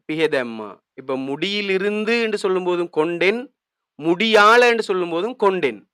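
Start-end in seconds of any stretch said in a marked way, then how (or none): none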